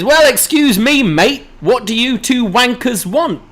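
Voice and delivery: a hammed-up cheeky British bloke; British impression. Bron mocks the British tag team, high British accent. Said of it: "Bron tries an impression of his own".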